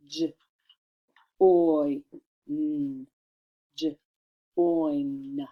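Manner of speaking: "J, Oy, N" each isolated, then "J, Oin"; voiced juh for "J", long "Oy", hummed "N"; clear sounding out. Shown as a demonstration. Teacher redoing it clearly, "J, Oy, N" sound by sound. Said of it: "I wanna make it clear".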